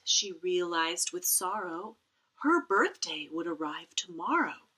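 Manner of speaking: ordinary delivery, nothing marked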